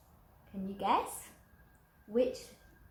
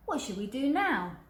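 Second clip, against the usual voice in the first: a touch louder, lightly voiced big skeleton; 4 semitones higher, 5 dB louder than usual. Big skeleton asking the others, lightly voiced. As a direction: ask the group what's next, a bit louder. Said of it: "he asked".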